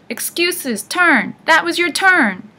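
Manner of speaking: put-on high, loud old-hag retort; squabbling. A narrator's reading. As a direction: argue it hotly with your sister.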